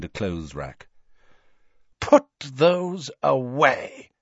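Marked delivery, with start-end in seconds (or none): 2.0-4.1 slow, higher-pitched icy order; coldly imperious. A narrator's reading. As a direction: give a cold, deliberate command. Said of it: "she said coldly to Harry and Ron".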